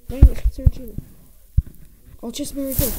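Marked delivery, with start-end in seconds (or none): 0.1-1.0 much louder annoyed exclamation; frustrated loud groan of words; loud frustrated outburst. Real, not acted. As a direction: blurt out the letdown.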